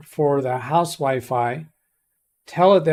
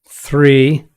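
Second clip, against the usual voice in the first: over enunciating; 7 dB louder than usual. Shown as a demonstration. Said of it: "I need you to not repeat".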